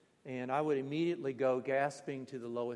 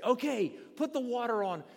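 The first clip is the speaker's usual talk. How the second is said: high, bright call to pour the water; cheerful Finn call; 10 semitones higher, 5 dB louder than usual. Retold or imitated. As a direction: call out to ladle water on the stones. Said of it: "And they say".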